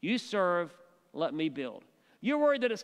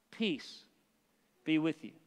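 gentle blessing; 3 semitones lower than usual. Acted. Risen Jesus greets scared disciples.

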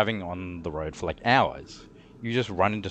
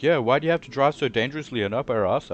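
a touch louder, higher mock complaint; imitating angry ladies; 6 semitones higher, 6 dB louder, faster than usual. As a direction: retell the other driver scolding you, hammed-up. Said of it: "They're like".

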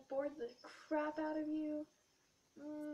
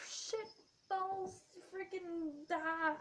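exasperated curse; 4 semitones higher, 6 dB louder, much slower than usual. Real, not acted. Frustrated curse on remembering homework.

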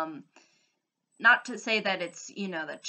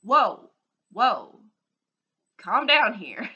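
exasperated; 2 semitones higher, 9 dB louder, slower than usual. Retold or imitated. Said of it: "And I'm just like".

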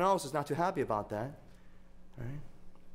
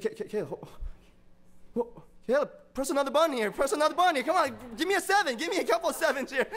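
high, loud, stammering into a rapid plea; excited urging; 11 semitones higher, 7 dB louder, faster than usual. Retold or imitated. Dad begging Caleb to press another button, comically over-excited. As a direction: egg the toddler on, loud and high-pitched.

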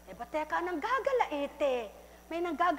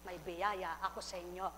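a touch of tender promise; 6 semitones lower, 7 dB quieter than usual. A narrator's reading. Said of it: "Sabi ng ama".

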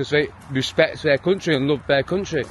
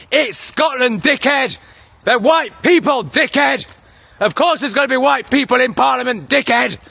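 raised, exasperated yelling at a politician; angry rant; 6 semitones higher, 4 dB louder than usual. Real, not acted. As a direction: bark each dickhead like you're fed up. Ranting at Scottish PM's speech.

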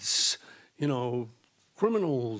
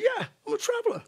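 mock eager agreement; faster than usual. Playing along with the mugger.

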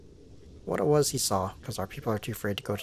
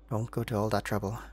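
usual speaking voice

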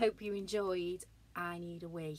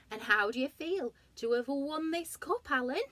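announcer voice; 7 semitones higher, 7 dB louder than usual. Acted. Announcer interviewing.

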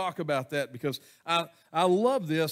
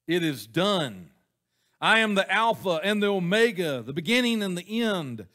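booming scripture read; 3 semitones higher, 5 dB louder than usual. Acted. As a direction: proclaim it like the voice of God. Reading God's words from Revelation.

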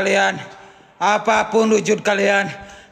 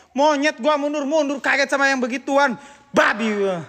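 ordinary talk

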